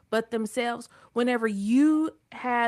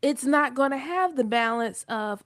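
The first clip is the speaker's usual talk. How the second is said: usual speaking voice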